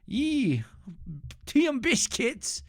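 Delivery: high-pitched, hammed-up British accent; bad British accent. Streamer mocking Britain to viewers, bad British accent. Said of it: "Can I do a British accent".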